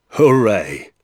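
loud hurrah; pleased cheer. Acted. Father applauding the doctor's needle revenge, cheering.

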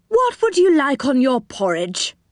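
lightly voiced storybook host; cheery host. A narrator's reading. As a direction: offer breakfast toppings in a high, eager voice. Host offers breakfast to guest.

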